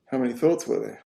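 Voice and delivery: ordinary talk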